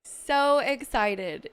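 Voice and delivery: excited. Real, not acted. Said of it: "I literally was so excited".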